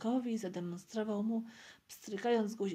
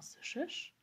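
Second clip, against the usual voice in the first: whispered question, hushed and secretive; 6 semitones higher, 4 dB quieter than usual. A narrator's reading. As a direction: ask it in a whisper. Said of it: "szepnął". (pytanie szeptem)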